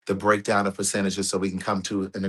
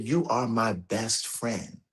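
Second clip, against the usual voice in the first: softer and slow, a hint of tears; tearful friend, softly; 7 dB quieter, much slower than usual. Retelling his friend's tearful call, hushed and slow. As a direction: say it gently like holding back tears. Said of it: "she broke down and she was crying".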